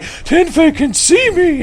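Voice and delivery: a frightened viewer hiding in his closet; scared fan. Retold or imitated. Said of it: "He's like".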